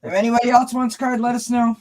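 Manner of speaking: brisk, high call to viewers; upbeat announcement. Real, not acted. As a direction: pitch it up and sell the cards. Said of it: "announcing to live viewers".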